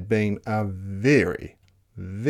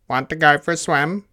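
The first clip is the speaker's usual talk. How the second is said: quick, loud, higher duck question; 4 semitones higher, 5 dB louder, faster than usual. Acted. Duck inviting the spider to swim, quick quacky call. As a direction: quack the words out fast. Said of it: "called the duck".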